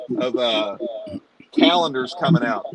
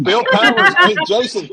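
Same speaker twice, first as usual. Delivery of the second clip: high excited calling out; 3 dB louder than usual. Real, not acted. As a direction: call out excitedly.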